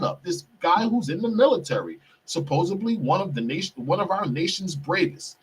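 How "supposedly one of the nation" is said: ordinary delivery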